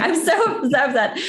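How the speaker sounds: high, choked laugh; laughing through tears. Real, not acted. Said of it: "You're getting choked up".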